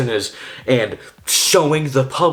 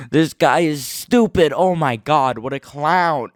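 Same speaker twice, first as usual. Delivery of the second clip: mocking commentator; 3 semitones higher than usual. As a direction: jeer at him like a troll. Parody commentator insults.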